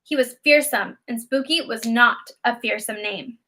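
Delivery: plain reading voice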